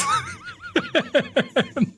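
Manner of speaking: very high squeal of a laugh; high-pitched laughing squeal. Real, not acted. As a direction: burst into a shrill giggle. Laughing at the nipples joke.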